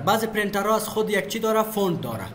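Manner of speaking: ordinary talk, nothing marked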